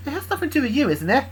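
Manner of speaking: lightly voiced story character; high character voice.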